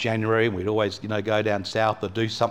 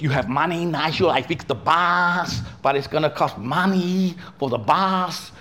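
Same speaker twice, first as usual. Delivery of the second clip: put-on high, slow Vietnamese-accented mechanic; 5 semitones higher, slower than usual. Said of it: "And he goes".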